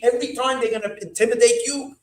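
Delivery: rising to a loud high shout of garnished; demonstrated yell. Shown as a demonstration. Rebbe showing how to yell at them, loud building shout. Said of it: "That's how you're gonna have to yell at them".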